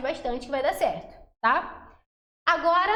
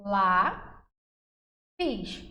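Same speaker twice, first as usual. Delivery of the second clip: "lá, pis" split by a long pause; drawn-out syllables, "lá" apart from "pis"; 4 semitones lower than usual. Shown as a demonstration. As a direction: say "lá", wait, then "pis". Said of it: "Eu separo".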